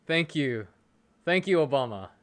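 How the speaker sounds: usual speaking voice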